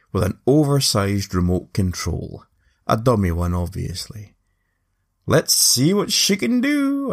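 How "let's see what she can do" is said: high, slow showman's call; shouted. Retold or imitated. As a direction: announce it to the crowd. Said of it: "I shouted".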